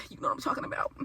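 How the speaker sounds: whispered you know what I'm talking about; hint it slyly under your breath. Retold or imitated. Teasing the frat knowingly, whispered. Sly whispered text quote.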